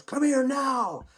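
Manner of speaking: high, drawn-out call to come over; retold holler, high and slow; imitated urgent summons. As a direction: call someone over urgently, stretching the words. Mechanic summons him in story.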